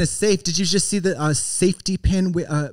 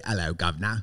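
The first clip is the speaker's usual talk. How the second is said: mock British; 10 semitones lower, 7 dB quieter than usual. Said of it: "don't say".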